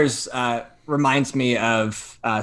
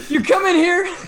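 high, loud threat through laughter; retold yelling; 6 dB louder than usual. Threatening the doorbell woman, yelling and laughing. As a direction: yell a threat, breaking into laughs. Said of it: "I start yelling at her".

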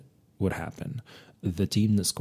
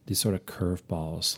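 ordinary talk, nothing marked

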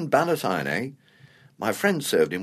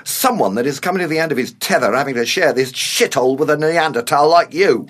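louder, building to an insult; exasperated retort; 3 semitones higher, 5 dB louder than usual. Acted. Older man sick of sharing with him, exasperated. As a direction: at the end of your tether.

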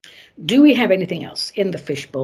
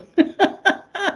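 high laugh; 11 semitones higher than usual. Real, not acted. Laugh after the denial.

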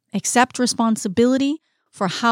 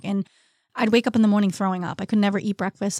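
usual speaking voice

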